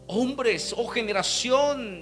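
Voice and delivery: high, exclaiming pulpit voice; preaching rebuke. Acted. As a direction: cry it out as an exasperated rebuke.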